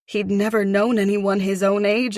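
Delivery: ordinary delivery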